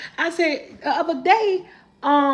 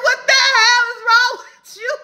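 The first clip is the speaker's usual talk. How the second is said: high, loud, half-sung laughing scold; 11 semitones higher, 6 dB louder than usual. Real, not acted. Scolding her son over the pillowcase, laughing. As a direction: scold him while cracking up.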